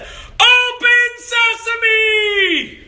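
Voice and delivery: high, very slow shouted magic words. Retold or imitated. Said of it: "So then I shouted even louder".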